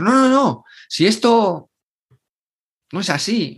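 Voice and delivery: high, hurried mock "No, no, no"; eager protest. Imitating a client eager to qualify, a high hurried protest. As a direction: play the prospect rushing to prove they fit.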